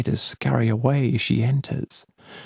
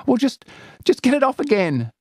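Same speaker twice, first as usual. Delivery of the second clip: high hurried plea; anxious girl; 4 dB louder, faster than usual. Acted. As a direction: get me out. Celia anxious.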